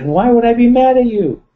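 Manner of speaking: high, loud, baffled retort; loud mock disbelief, voice leaping; incredulous. Voicing Sarah's incredulous reply.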